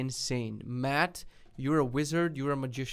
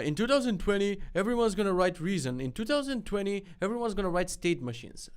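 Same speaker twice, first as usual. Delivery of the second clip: high singled-out mock quote; pitched-up, exaggerated mimicry; mocking tweets; 7 semitones higher, 4 dB louder than usual. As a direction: parody hyped-up prediction tweets. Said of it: "but I hate the tweets".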